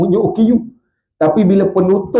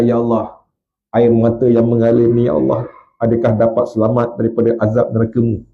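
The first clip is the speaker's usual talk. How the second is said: lower, hushed plea; 7 semitones lower than usual. Acted. Preacher retells the prayer to the congregation, hushed. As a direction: murmur the question to God.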